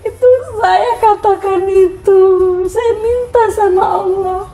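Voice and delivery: high, tear-choked telling; real crying. Real, not acted. Woman telling the interviewer of her prayers, crying for real. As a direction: speak while truly crying.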